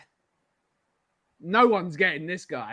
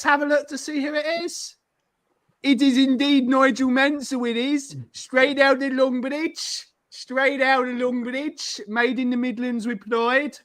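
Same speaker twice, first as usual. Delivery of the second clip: put-on botched Brummie accent; 4 semitones higher than usual. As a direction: attempt Brummie and land somewhere near South African. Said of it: "You make him sound South African".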